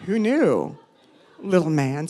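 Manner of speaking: ordinary delivery, nothing marked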